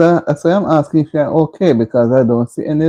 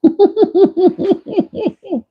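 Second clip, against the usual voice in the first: high laugh. Real, not acted. Laughs at comment.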